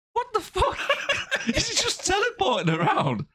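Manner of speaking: high, laughing disbelief; high-pitched amused what-the-fuck; incredulous laughing. Real, not acted. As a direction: crack up in disbelief at how daft it is.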